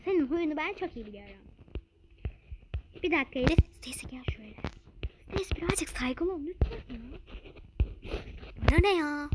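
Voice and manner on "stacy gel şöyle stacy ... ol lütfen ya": hushed scolding whisper. Acted. Mother whispers to Stacy to be polite to the guest. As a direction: lean in and whisper a warning to the child. Mother hushing rude child privately.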